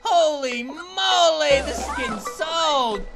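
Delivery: loud, high whoops of disbelief; excited celebration. Real, not acted. Player finally hits two fifty, excited celebration. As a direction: cheer yourself on, drawing out the last words.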